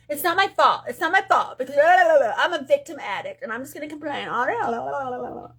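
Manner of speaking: high, whiny mock victim voice, rushed at the end; mock a complaining ex-wife who plays the victim; hammed-up whining ex-wife; whiny ex-wife impression. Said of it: "I'm acting like your ex-wife".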